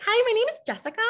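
very high, chirpy sales greeting; customer service voice. Shown as a demonstration. Cold-caller greeting a customer, high and sweet. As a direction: sell it with a sugary high hello. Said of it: "because I sounded like that".

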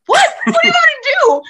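very high, loud yelp; comic high-pitched bewilderment; retold startled protest. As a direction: squeal it in mock disbelief. Startled bartender protests being blamed.

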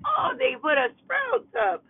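sudden thrilled shout, drawn out; excited outcry. Real, not acted. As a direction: cry out with delight at the sight. Spotting a new store while driving.

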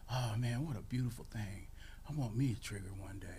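lightly voiced, soft admiration; a hint of wistful horse lover, softly.

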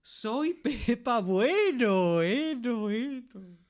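very slow, high proclamation with the last word echoing away; slow echoing announcement. Acted. Pepa Bueno, a being of light, introduces herself with slow echo. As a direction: announce yourself like a voice from the heavens.

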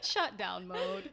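slow shutting-down robot. Pretend. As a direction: power down like a robot.

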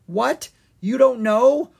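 high, rising disbelief; incredulous. A narrator's reading. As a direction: question it in astonishment. Owen incredulous at his sister.

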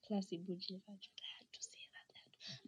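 a secret aside to the viewers; hushed confession of a word; whispered aside. Real, not acted. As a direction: whisper it under your breath.

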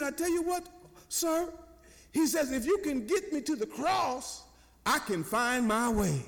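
high, eager retold child voice; excited little boy. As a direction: mimic a boy who has found his bearings. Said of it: "he's excited now".

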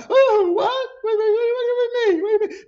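very high, loud, babbled confusion; hammed-up panicky squeal; mock flustered reply. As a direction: exaggerate being caught off guard by the boss. Acts his flustered reply to boss.

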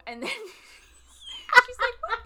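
high, loud laughing words. Real, not acted. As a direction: laugh through the line. Laughing while retelling.

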